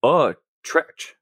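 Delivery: "Utrecht" with an oh-like first vowel and a "tretch" ending; alternative pronunciation. Acted. Querying the agent's word, "Utrecht" pronounced as oh-tretch. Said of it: "I thought it was pronounced".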